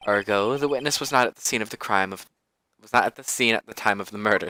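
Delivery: ordinary talk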